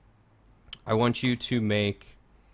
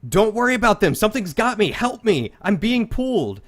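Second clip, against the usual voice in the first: high, loud panicked pleading; terrified man being pulled into a bush begs guard; desperate begging; 11 semitones higher, 7 dB louder than usual. Acted. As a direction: beg for your life as you're dragged away. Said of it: "he is begging for help".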